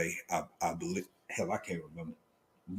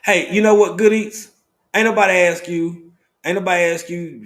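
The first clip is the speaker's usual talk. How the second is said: mock call-out; 5 semitones higher, 6 dB louder than usual. Teasing a chat viewer.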